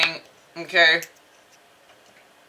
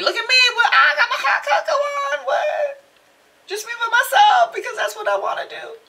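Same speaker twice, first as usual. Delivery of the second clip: sassy selfie voice; 6 dB louder than usual. Pretend. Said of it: "Even a selfie".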